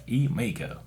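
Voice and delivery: Emiko said "ee-MAY-ko", Southern style; southern accent. Shown as a demonstration. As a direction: give Emiko a hillbilly southern twang. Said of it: "The southern, southern accent".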